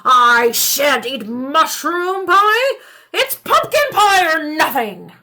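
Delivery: put-on loud, slow, stubborn old man; indignant shout. Old man loudly refuses the rapscallion's mushroom pie. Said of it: "shouted the old man".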